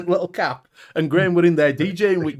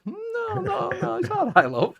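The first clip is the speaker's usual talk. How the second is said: high flustered reply; 3 dB quieter than usual. Retold or imitated. His flustered reply retold.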